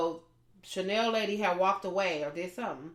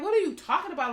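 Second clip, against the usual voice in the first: pitched-up retort, louder; what are you talking about, high and sharp; indignant surprise; 9 semitones higher, 4 dB louder, faster than usual. Retold or imitated.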